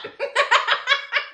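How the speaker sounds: high burst of laughter; laughing. Real, not acted. Host laughing at the sheriff's admission, laughter. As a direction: laugh hard at the line.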